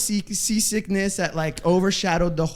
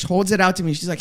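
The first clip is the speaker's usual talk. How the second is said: normal talking voice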